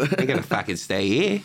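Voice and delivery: put-on Australian accent. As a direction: put on a broad Aussie accent. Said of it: "do a really good Australian accent".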